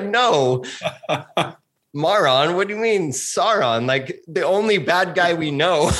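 high, laughing mock protest; retelling a dream to a friend, laughing incredulously; laughing disbelief. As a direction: laugh it off as absurd.

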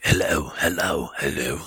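deep padre voice. Shown as a demonstration. Recording takes for the developers in the deep English voice.

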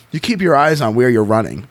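plain reading voice

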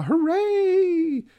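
a sudden high, mock-triumphant hooray; mock cheer. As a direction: cheer it like a cartoon crowd.

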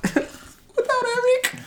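high-pitched laugh through the words. Real, not acted. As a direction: laugh out the name. Laughing while teasing absent friend.

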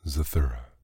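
ordinary delivery, nothing marked

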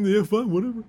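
high-pitched, dismissive mock pout; mock sulk. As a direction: play the spurned suitor shrugging it off. Joins in acting rejected emperor.

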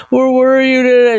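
high, drawn-out tearful wail; slow whiny sob-talk, hammed up; mock crying. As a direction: imitate a drunk friend blubbering. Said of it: "Cynthia's in the passenger side crying".

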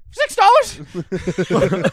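drawn-out mock-fearful question; scared. Cashier questioning the two Whopper price, scared. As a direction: imitate a rattled cashier, slow and high. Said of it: "and she was scared".

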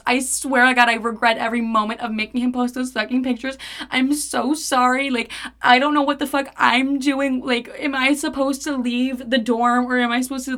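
acted panicky ramble, pitch climbing; scared word vomiting. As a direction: pour it all out in a retold panic. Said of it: "word vomiting at this point to her 'cause I'm so scared".

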